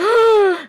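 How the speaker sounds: gasp. Real, not acted. Shocked at the gossip.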